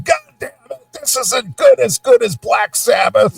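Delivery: high shouting grumpy metalhead; cranky old rocker. Retold or imitated. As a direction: rant like a bitter old rocker. Mocking complaining rock fan.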